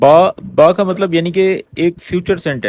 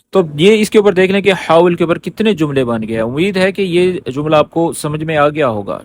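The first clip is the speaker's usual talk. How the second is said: ordinary talk, nothing marked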